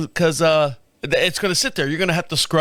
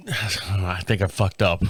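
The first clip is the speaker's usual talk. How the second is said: regretful sigh; 10 semitones lower, 4 dB quieter, slower than usual. Real, not acted. Sighing realization of a mistake.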